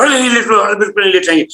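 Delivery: pitched well up, insisting hard; a patient who has just come round refuses to lie down; insistent refusal. Retold or imitated. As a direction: flatly refuse to lie down, voice up high.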